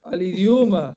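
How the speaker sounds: hammed-up offended objection; mock outrage. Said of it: "hubiese dicho".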